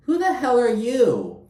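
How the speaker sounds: high, loud incredulous outburst; outraged. Real, not acted. Vlogger fuming at Brynn on the show, loud high outrage.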